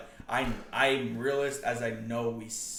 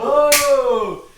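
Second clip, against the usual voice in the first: surprised whoa; 11 semitones higher, 13 dB louder than usual. Real, not acted. Reacting to a drinking mishap.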